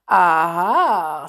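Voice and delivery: loud, drawn-out knowing aha; great satisfaction. A narrator's reading. Inspector hears the Roma is coming, satisfied. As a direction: relish the discovery. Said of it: "with great satisfaction in his voice".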